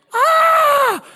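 high, loud scream of pain. Retold or imitated. Little boy burning his mouth on hot sauce, full scream. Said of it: "I'm screaming".

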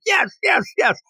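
very high, eager imitation; mock eagerness. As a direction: squeal agreement like over-excited students. Students cheering on, retold.

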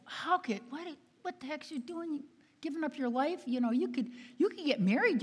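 high, incredulous student voice; baffled college kid. Retold or imitated. College kids question sister's life choice, imitated bafflement. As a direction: imitate a student stumbling in disbelief.

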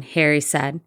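ordinary delivery, nothing marked

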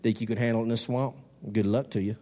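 low, lightly imitated swamp accent; a touch of low-pitched Swamp People accent. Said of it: "If you watch Swamp People".

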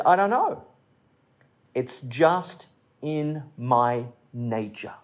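lightly voiced scorpion, slowing and dropping low; low, slow admission, lightly acted; matter-of-fact scorpion. Scorpion explaining the sting to the frog, lightly acted slow admission. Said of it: "Scorpion said".

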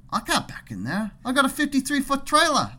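high-pitched mock Aussie protest; Australian accent. Trucker objecting to backing in, mock Australian accent. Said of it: "making fun of his accent".

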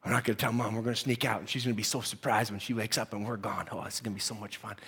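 excited hushed whisper; giggly secret, barely voiced. Sneaking out without Mom.

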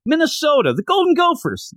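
put-on high, eager college kid voice; timid player answer. Player answering the coach, high and eager. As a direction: pipe up like a nervous recruit.

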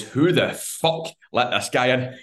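put-on slow, slurred drunk voice, laughing through it; a drunk girl mock-snarling at a stranger, laughing. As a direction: play her as a laughing drunk. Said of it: "Steaming, obviously".

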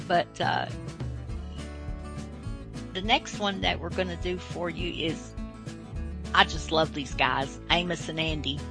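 usual speaking voice

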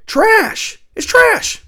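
high, loud outburst of disgust; furious shout. Real, not acted. Ranting to viewers about the ACC's TV deal, furious high shout. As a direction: spit the word out at the top of his voice. Rant about TV deals ruining conferences.

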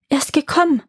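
joyful exclamation, pitched a little up. A narrator's reading. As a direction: burst out with joy at his arrival. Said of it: "freute sie sich".

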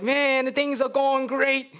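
high, slow self-satisfied boast; gloating Haman. Acted. Haman gloating about his success, high and slow. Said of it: "He's like".

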